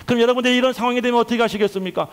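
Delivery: ordinary talk, nothing marked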